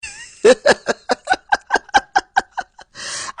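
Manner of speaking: long, high-pitched burst of laughter; big real laugh, high and loud. Real, not acted. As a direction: crack up loudly in a rapid, high cackle. Laughing at the played clip.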